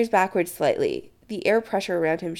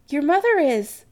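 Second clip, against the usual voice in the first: higher, mildly shocked; lightly acted shock, pitch raised; aghast; 9 semitones higher, 4 dB louder than usual. Adam to Chris about his mother, mildly aghast. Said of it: "Adam says aghast".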